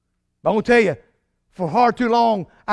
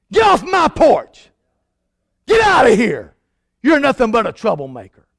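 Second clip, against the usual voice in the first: angry homeowner; 3 semitones higher, 5 dB louder than usual. Pretend. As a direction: chase the preacher off the porch. Imagined homeowner slamming door.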